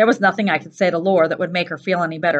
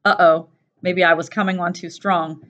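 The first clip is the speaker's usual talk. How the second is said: usual speaking voice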